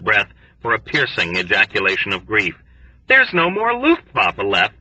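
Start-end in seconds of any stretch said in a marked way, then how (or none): 3.1-4.8 higher, grieving lament; mild wail. A narrator's reading. Doktonika to Yossarian, a mild wail of grief. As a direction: mourn it like a lost friend. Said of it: "he wailed".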